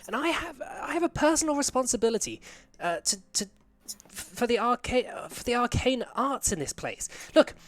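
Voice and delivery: high, insistent female voice; high-pitched official insisting on being let in; indignant woman's voice. Acted. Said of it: "like, a female's voice going".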